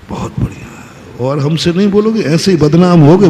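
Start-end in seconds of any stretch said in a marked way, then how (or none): 0.1-0.6 whispered smug reply; hushed, sly satisfaction. Acted. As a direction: breathe it out secretly pleased. Listener enjoying praise, mock reply.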